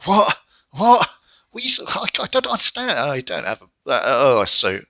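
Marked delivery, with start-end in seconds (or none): 0.0-2.9 hearing Nakamura switch languages, high baffled squeal. Retold or imitated. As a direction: squeak out total confusion. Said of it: "and I'm like".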